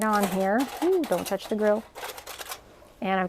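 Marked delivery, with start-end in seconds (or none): none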